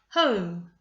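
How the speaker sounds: HOME loud, falling on one beat; one clapped beat. Shown as a demonstration. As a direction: punch HOME as a single syllable. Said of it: "I'm going to clap as I say the word".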